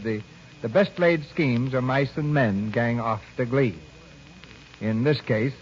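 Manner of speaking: usual speaking voice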